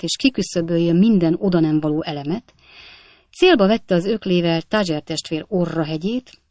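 ordinary delivery, nothing marked

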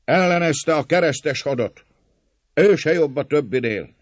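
high, loud angry interjections; crowd shouts. A narrator's reading. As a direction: cut the speaker off from the crowd. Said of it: "íszózatok szakíták félbe a szólót".

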